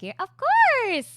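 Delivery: high, loud, drawn-out exclamation; stretched, over-the-top agreement; eager mock reply. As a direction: sing out an enthusiastic yes. Acts out own eager reply.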